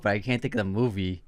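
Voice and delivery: ordinary talk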